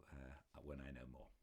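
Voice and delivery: whispering. Real, not acted. Aside whispered before reading.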